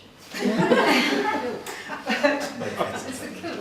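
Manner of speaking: loud real laughter breaking out at her own joke; burst of laughter. Real, not acted. Artist laughing at her own joke to the audience, loud burst of laughter. Joke about Scottish-looking figure.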